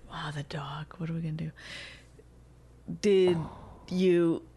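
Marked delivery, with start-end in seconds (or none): 0.1-1.5 hushed whisper about the dog. Real, not acted. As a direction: whisper it quickly.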